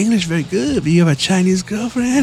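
mock Chinese accent. Customers tripping out at his Chinese, mock Chinese accent. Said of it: "start talking to them in Chinese".

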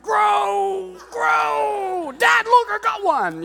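put-on high, loud excited kid voice; mock boy pleading. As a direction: urge the hair to grow like an eager boy. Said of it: "they're like".

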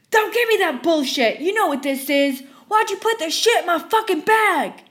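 furious customer shrieking; screaming. Retold or imitated. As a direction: scream it waving the cup. Rabid customer yelling.

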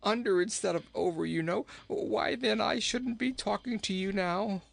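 high-pitched, chattering storybook king with giggles; jolly king in trouble. Acted. King in a well tells his rescuer his mishap, high and chirpy. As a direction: prattle on high, then hoot with laughter.

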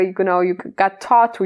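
ordinary talk

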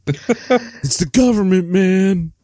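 stoner burnout, drawn-out and high; Dennis Hopper. Acted. Picturing the comment writer as Dennis Hopper, spaced-out hippie impression.

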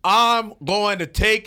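host lightly imitating a candidate's speech, slow and loud; Biden impression. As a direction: drag it out like a stump speech, lightly. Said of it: "candidate Biden was talking about how".

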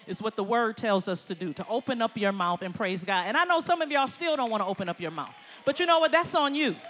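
loud, fired-up preaching; preaching at full force. Acted. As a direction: drive the point home to the congregation. Preaching to the congregation.

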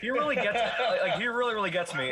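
laughing. Real, not acted. Laughing while praising.

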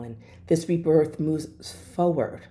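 usual speaking voice

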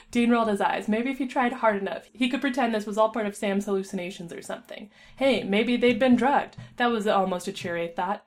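usual speaking voice

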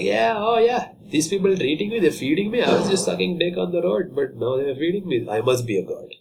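high, smug cat voice. Retold or imitated. Cat gloating about being fed. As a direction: preen like a spoiled cat. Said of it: "Cats are like".

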